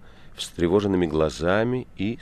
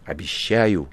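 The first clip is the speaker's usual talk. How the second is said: loud, solemn oath; 8 dB louder, slower than usual. A narrator's reading. Ivan vows to the guest, solemnly. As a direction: proclaim it like a vow. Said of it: "торжественно произнёс Иван".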